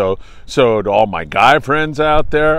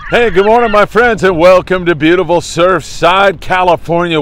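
upbeat travel show host; 4 semitones higher, 4 dB louder than usual. Acted. Opens the show on camera.

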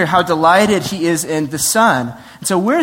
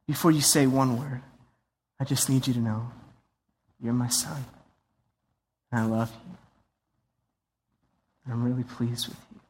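much softer, lower, intimate; tender father; 5 semitones lower, 9 dB quieter than usual. Pretend. The Father telling Jesus he loves him, tender. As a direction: speak it softly to your son. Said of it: "almost as if to say".

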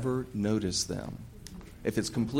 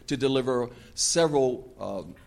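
normal talking voice

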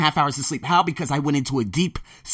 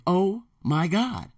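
slow, higher "oh my God"; amazed, drawn out; 6 semitones higher, much slower than usual. Retold or imitated. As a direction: stretch the amazement out. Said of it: "the next person is gonna say".

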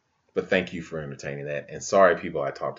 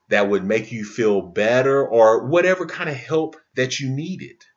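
louder and higher, building with feeling; passionate outburst; 7 semitones higher, 8 dB louder than usual. Real, not acted. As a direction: pour out the conviction. Passionate rising plea.

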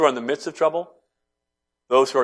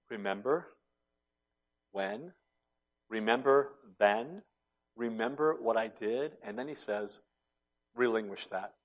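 soft, gentle reminder; gentle hushed voice; 7 dB quieter than usual. Acted. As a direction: coax the listener softly. Savior's gentle words voiced.

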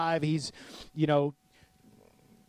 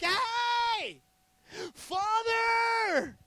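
joyful calling out; much slower than usual. Retold or imitated. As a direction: call out to him like a delighted child. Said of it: "he prays with joy".

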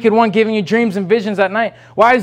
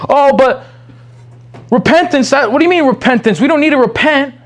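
mock objector; 5 semitones higher, 4 dB louder than usual. Voices objector rejecting repentance.

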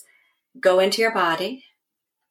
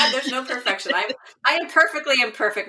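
laughing reply; 6 semitones higher than usual. Real, not acted.

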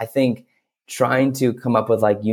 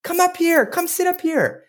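wood carver. Acted. Wood carver calls to Punchinello at the door, high gushing welcome. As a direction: greet him in a big high storybook voice. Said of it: "the wood carver is like".

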